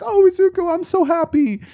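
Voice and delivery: very high mock-weepy mother; happy crying. Mother overcome by her son's win, tearfully happy. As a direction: wail it in hammed-up joyful tears. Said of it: "Her crying, and she's like".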